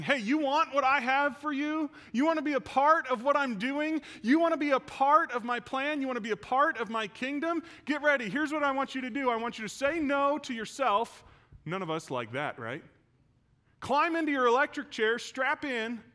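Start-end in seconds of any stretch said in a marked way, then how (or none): 0.0-11.2 raised, urgent preacher's challenge; Jesus daring his followers to say no to themselves, loud and urgent; challenging call. Retold or imitated. Jesus challenging the disciples, raised and urgent. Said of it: "Jesus is saying". 13.8-16.0 forceful call. Retold or imitated. Preacher voicing Jesus' demand.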